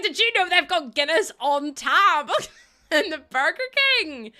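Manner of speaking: a touch of gushing excitement; excited tourist. Retold or imitated. Viewer voicing an American tourist telling about Dublin airport, excited. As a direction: gush about it, laughing. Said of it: "You're like".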